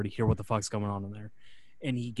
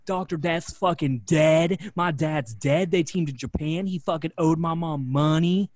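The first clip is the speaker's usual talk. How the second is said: louder, higher, slow-starting rant; retold yelling; 5 semitones higher, 5 dB louder than usual. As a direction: go off on the boss. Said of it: "And he starts yelling".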